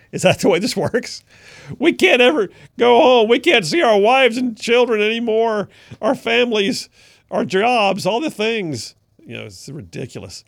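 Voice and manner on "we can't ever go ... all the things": high, loud mock lament; mock whine. Host mocking fleeing Democrats who can't go home, loud whiny lament. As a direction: whine like a self-pitying exile.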